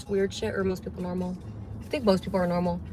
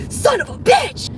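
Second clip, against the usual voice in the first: mock curse; 14 semitones higher, 5 dB louder than usual. People cursing at the mirror.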